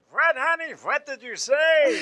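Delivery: lightly voiced old deli man.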